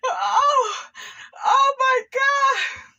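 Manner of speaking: shocked shriek. Retold or imitated. Reliving seeing the episode, a high shocked squeal.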